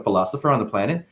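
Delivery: ordinary talk, nothing marked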